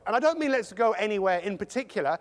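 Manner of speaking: ordinary delivery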